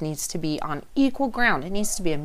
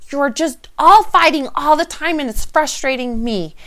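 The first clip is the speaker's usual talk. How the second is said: higher and louder, fed-up scolding; mother scolding her fighting kids, much louder and higher; exasperated yell at kids; 8 semitones higher, 7 dB louder than usual. Retold or imitated. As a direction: snap at the kids like a frazzled parent. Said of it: "I could blame the result on my kids and say".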